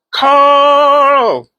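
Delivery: high loud drawn-out call of a name; excited call. Real, not acted. Calling her friend Carl's name again, high and loud. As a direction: hail your friend across the room.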